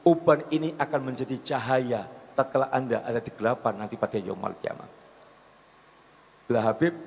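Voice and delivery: ordinary talk, nothing marked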